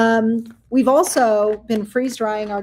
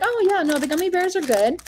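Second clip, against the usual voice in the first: higher, breezy brush-off; mock awkward reply; 8 semitones higher than usual. Speaker brushing off a question at a party, higher and breezy. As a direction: lightly play your flustered self.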